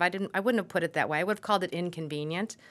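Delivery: ordinary talk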